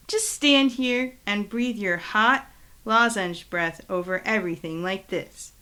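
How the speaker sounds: ordinary delivery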